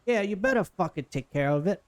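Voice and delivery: put-on New Jersey housewife, slowing as she grumbles. Boss woman scolds her crew before leaving, New Jersey accent. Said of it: "like, a housewife of New Jersey".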